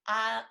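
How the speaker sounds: short, loud, low "uh"; jaw-release noise; demonstrated open-jaw "uh". Shown as a demonstration. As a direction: drop the jaw and let out a loud "uh". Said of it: "you can make a noise as well".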